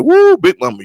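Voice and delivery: high, loud woo of praise; delighted whoop. Retold or imitated. Grandchild praising Big Mama's cooking with a loud whoop. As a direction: holler your joy at the cooking. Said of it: "You know, like".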